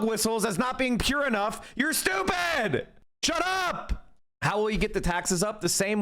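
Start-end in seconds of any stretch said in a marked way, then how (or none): 1.8-4.1 higher, slowed, yelled put-down; exasperated shout. Real, not acted. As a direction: snap at the audience, fed up. Berating critics in angry rant.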